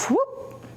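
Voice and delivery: squeaky high whoop; a leaping cartoon whoop; pig jump whoop. Storyteller voicing the pig jumping, high whoop.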